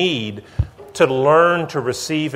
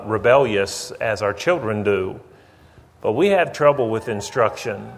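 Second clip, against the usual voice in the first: ordinary delivery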